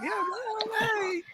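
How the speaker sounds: normal talking voice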